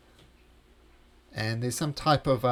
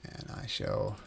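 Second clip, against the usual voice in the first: whisper; 8 dB quieter, slower than usual. Acted. DM whispers creature aside.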